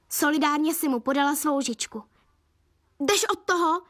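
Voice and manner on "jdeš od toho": mild scolding cry, higher pitch; called out sharply. A narrator's reading. Mum catching dad at the ice-cream cake, mild shout. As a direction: warn him off, raised in pitch. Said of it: "křikla mamka".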